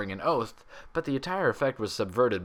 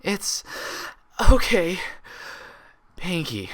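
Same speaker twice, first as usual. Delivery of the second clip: wheeze; 9 semitones higher, 5 dB louder, slower than usual. A narrator's reading. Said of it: "she managed to wheeze".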